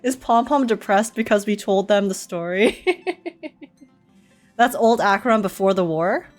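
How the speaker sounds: ordinary talk, nothing marked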